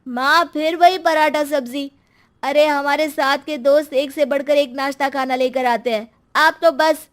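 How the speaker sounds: put-on loud complaining child; whining child. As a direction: grumble about breakfast. बच्चा नाश्ते की शिकायत करता है. (नाश्ते पर चिढ़कर शिकायत करता बच्चा)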